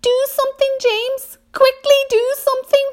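very high, urgent panic; crying out. A narrator's reading. Earthworm begs James to save them, frantic cry. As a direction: plead frantically at the top of the voice range. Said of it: "cried the earthworm".

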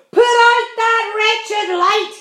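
slow, high, very loud bellow; furious shout. A narrator's reading. Centipede bellowing at the glowworm, furious. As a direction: hurl the words like the boot. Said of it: "he shouted".

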